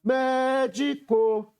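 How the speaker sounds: "médico" called out high, MÉ held long; chamando a palavra. Shown as a demonstration. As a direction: stretch the first syllable of "médico" on a high note. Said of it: "Chamando a palavra médico para descobrir a sílaba tônica". ("médico" com o MÉ alongado e agudo)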